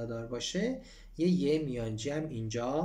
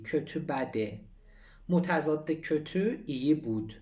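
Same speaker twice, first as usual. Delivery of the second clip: ordinary delivery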